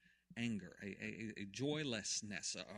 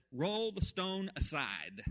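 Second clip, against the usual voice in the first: slow loud command; 6 semitones higher, 6 dB louder, much slower than usual. Acted. Jesus commands.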